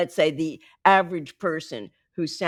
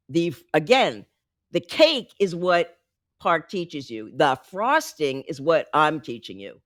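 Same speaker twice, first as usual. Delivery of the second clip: ordinary talk, nothing marked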